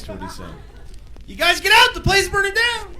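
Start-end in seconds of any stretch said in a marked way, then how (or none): 1.3-2.9 high-pitched urgent call; alarmed warning. Acted. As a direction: warn everyone of a fire. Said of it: "doesn't know how to match his face".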